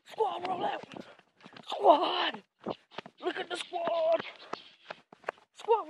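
high, loud rallying cry; drawn-out holler to the troops. Real, not acted. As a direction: shout them onward from across the yard.

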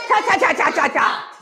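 forceful cha cha chant. Shown as a demonstration. Preacher demonstrating the chant with force for the church. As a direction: spit each cha out hard. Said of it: "Say it with some fence".

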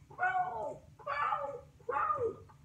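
high, soft whispered croaks; bullfrog croak. A narrator's reading. Reading the bullfrog's croak to a child, hushed. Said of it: "The bullfrog below croaked".

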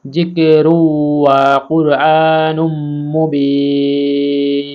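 slow, drawn-out recitation; Quran recitation. Acted. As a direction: stretch Mubin out long like tilawat. Recites the finished verse.

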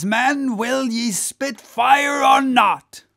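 high, loud goading of the rowers; rousing cry. Acted. Whaler to his crew racing the German boat, rousing cry. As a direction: whip up the crew, swelling to shouts. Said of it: "cried Stubb to his crew".

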